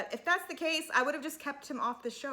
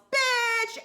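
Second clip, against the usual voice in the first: high, loud outburst of one word; sudden yell; 14 semitones higher, 9 dB louder than usual. Retold or imitated. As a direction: yell it out, imitating her friend's outburst.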